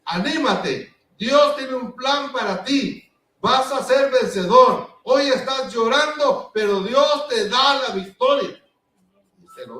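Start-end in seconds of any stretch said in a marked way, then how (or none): 0.0-8.6 encouraging. Retold or imitated. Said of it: "Como que dice".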